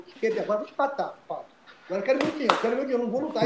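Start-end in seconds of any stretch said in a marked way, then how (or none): 1.9-3.4 higher, pushing demand for the money; hammed-up furious fighter; mock angry demand. Fighter demanding his money from the manager, a higher mock-angry voice.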